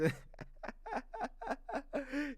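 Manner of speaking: long, high-pitched giggling laugh; high laughter. Real, not acted. Reading a comment about his English accent, high laughter.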